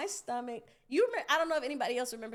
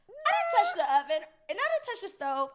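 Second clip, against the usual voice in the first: high, loud kid's defense, imitated; raised-pitch mock pleading; protesting child; 9 semitones higher, 5 dB louder than usual. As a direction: protest innocence like a kid in trouble. Child protests to angry dad.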